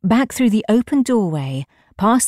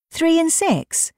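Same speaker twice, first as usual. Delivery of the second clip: high, drawn-out disbelieving question; incredulous, pitched way up and stretched out; 11 semitones higher, slower than usual. A narrator's reading. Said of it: "The young man was incredulous".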